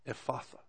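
Ephatha stressed eff-AH-thuh; emphasis on middle syllable. Shown as a demonstration. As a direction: put the weight on the AH in Ephatha. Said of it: "put the emphasis on the right place".

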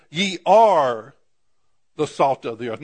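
ARE hit hard and loud, "ye ARE the salt of the earth"; stress on are. Shown as a demonstration. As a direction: punch the word are as a declaration. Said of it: "this is an emphatic".

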